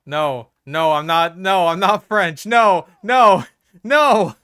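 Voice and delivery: mock outraged refusal; loud protest. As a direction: shout the denial down. Loudly denying being French.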